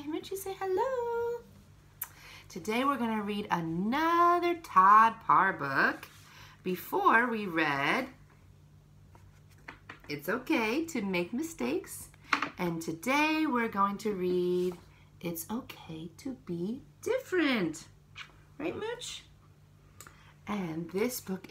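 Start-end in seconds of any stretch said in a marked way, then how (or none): 0.0-1.4 squeaky high voice for the dog; high pet talk. Real, not acted. Coaxing the pet to greet. 18.6-19.2 squeaky high voice for the dog; high pet talk. Real, not acted. Asking the pet.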